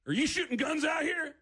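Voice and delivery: high-pitched, demanding cop question; stern cop. Retold or imitated. Cop getting out of patrol car, stern demand. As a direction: imitate an officer challenging suspects. Repeats the cop's challenge.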